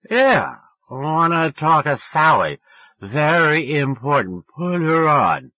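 put-on slow, loud drunken drawl. A narrator's reading. Drunk boy demanding Sally from grandmother, slow drunken drawl. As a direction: insist sloppily, high and dragging. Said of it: "I was so drunk".

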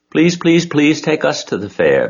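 high, loud pleading little gosling; begging gosling. A narrator's reading. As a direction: beg like a tiny goose chick. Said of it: "begged the gosling".

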